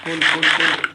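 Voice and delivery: loud hurried urging; loud urgent repetition. Real, not acted. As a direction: push it out loudly and fast. Urging to eat fast. (إلحاح بصوت عالي)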